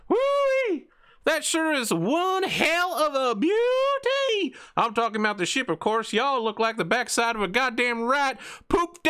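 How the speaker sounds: very high, loud, twangy Texan yokel. Acted. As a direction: whoop it up like a rowdy backwoods dockhand. GM voices Billy Bob.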